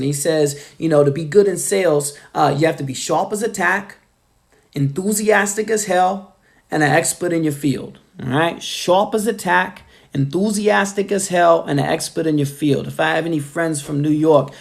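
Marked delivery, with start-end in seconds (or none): 2.9-8.0 put-on quoting in a New York accent, dropped R's. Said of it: "in this New York accent". 8.6-12.9 put-on punchy New York accent, dropped R's; imitated New York sales-guru accent. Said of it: "let me know how my accent is".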